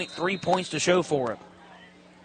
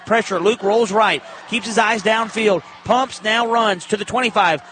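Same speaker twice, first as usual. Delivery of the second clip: louder, building play-by-play shout; excited call; 3 semitones higher, 4 dB louder than usual. Real, not acted. Announcer calls the quarterback scramble, building excitement. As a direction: ride the scramble with rising energy.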